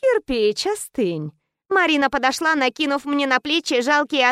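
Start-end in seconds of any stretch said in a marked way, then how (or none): 0.0-1.3 cold boss. Acted. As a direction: shut him down calmly. Boss calms the thug.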